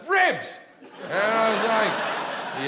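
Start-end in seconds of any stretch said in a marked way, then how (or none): 0.1-0.6 high, loud heckler's shout; sudden excited high yell. Retold or imitated. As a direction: yell it out from the crowd. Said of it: "one guy yells out".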